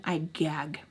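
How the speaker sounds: hushed, disgusted; whispered disgust. Retold or imitated. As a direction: breathe it out in disgust. Venting disgust to friend.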